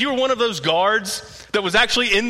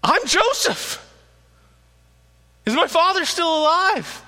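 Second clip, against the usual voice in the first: Joseph's reveal; 9 semitones higher than usual. Acted. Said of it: "enough to say".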